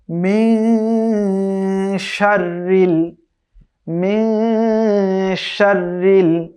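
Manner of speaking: drawn-out melodic tajweed chant; slow Quran recitation. Shown as a demonstration. Teacher recites slowly for students to copy. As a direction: recite each syllable long and measured.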